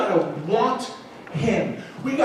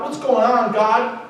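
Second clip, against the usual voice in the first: loud, high, pleading cry to God; a man finally shouting his prayer; desperate plea; 6 semitones higher, 5 dB louder than usual. Retold or imitated. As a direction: beg God out loud, at the end of your rope. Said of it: "I'm gonna get real with God".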